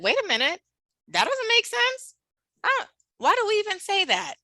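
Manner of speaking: high, incredulous pushback; wait, that makes no sense; mock indignant. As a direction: toss the idea out in disbelief. Acting out rejecting a script.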